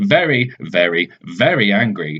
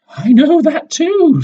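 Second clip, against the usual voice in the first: much higher, louder and slow, smug; Madam Know-It-All; 6 dB louder, slower than usual. Acted. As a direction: drag it out like she knows it all. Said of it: "says Madam Know-It-All".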